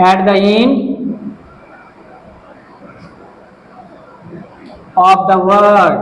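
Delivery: slow word-by-word dictation with long pauses; dictating while writing. Real, not acted. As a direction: spell it out piece by piece for students copying it down. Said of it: "আমি লিখে দিচ্ছি". (লিখতে লিখতে থেমে থেমে, ধীরে বলে দেওয়া)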